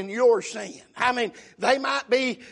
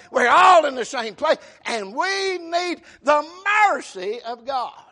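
raised, emphatic pulpit delivery; full-force preaching; 3 semitones higher, 5 dB louder than usual. Real, not acted. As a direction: drive each point home to the congregation.

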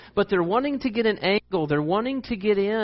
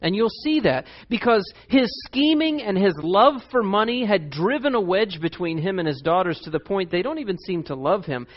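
usual speaking voice

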